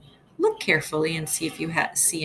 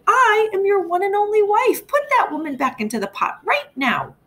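much higher, louder shrill-free cry of an outraged wife; indignant wife crying out; 14 semitones higher, 4 dB louder than usual. Acted. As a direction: demand it as the one and only wife, up high. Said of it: "the first Missus Hacktack cried".

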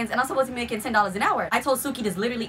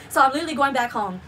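ordinary talk

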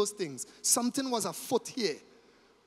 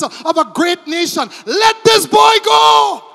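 building from firm pleading to a full shout, much louder; an impassioned plea rising to a shout; 6 semitones higher, 11 dB louder than usual. Acted. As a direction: plead harder and harder until you cry out. Judah pleads before Joseph.

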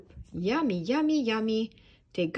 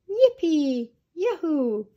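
high, loud storybook cheering; excited shout; 6 semitones higher, 7 dB louder, slower than usual. A narrator's reading. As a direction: shout each cheer with glee. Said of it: "shouted all of the penguins together".

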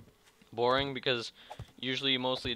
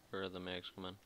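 ordinary talk

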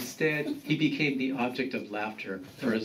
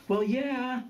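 high-pitched defensive grandson; 12 semitones higher than usual. A narrator's reading. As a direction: defend yourself, voice going up. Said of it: "Juan defended himself".

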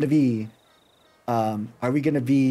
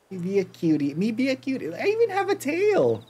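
cute high voice; 10 semitones higher than usual. Pretend. Showing cute cat costume.

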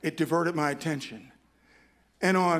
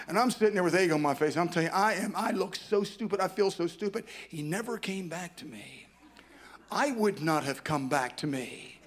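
ordinary talk

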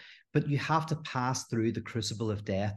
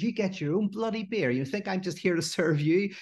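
imitated wife's outburst, much higher pitch; blowing up; 8 semitones higher than usual. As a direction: play the fed-up wife finally snapping back, high and sharp. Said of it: "And she blows up".

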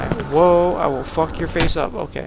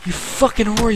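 ordinary talk

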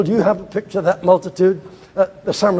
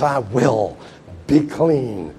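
lower, softer and gentle; tender reply; 5 semitones lower, 3 dB quieter, much slower than usual. Acted. Jesus answering the leper, soft and tender. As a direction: answer with quiet compassion.

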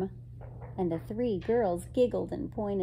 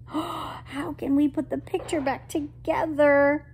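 higher, louder fretful wail; dismayed; 8 semitones higher, 5 dB louder than usual. Acted. Girl cannot repair the painting, dismayed. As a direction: cry out in acted dismay. Flowers stolen, picture cannot be fixed.

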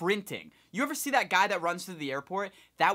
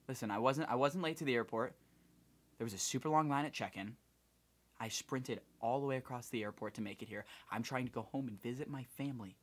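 quiet pleading; 4 semitones lower, 9 dB quieter than usual. Retold or imitated. Begging the gate manager.

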